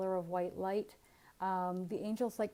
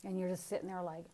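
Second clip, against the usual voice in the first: normal talking voice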